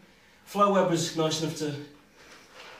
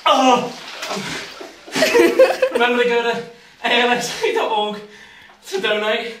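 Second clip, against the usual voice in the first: laughing, shivering through it; high, breathless laughs from the cold; in ice cold water; 5 semitones higher, 4 dB louder than usual. Real, not acted.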